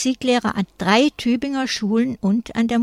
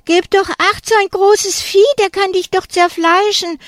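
hoher, lauter Angstschrei; schreiend; 11 semitones higher, 5 dB louder than usual. A narrator's reading. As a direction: schrei die Warnung panisch heraus. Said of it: "schreit Gabi". (Gabi schreit Tanja panisch vor dem Hund an)

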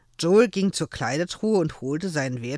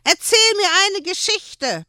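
put-on high pleading little boy voice; high child voice, pleading; begging child; 7 dB louder than usual. A narrator's reading. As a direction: beg like a small child. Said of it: "bettelte er".

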